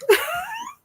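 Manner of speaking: sudden high-pitched laugh; high squeal of laughter. Real, not acted. Reacting to weird dog images.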